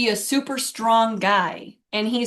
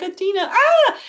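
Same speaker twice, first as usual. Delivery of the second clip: much higher, louder wail of grief; mock anguished cry; 10 semitones higher, 5 dB louder than usual. Retelling a death scene, mock wailing over the friend. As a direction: ham up the heartbroken scream. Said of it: "she's like".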